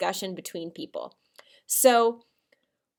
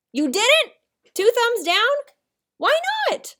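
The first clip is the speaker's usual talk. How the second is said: mock surprise; 14 semitones higher, 5 dB louder than usual. Teacher pretending kids disapprove.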